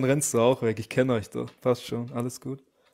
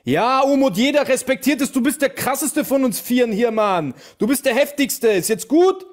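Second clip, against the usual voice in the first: sarcastic flattery; 7 semitones higher than usual. Acted. Sarcastic praise to calm a troll.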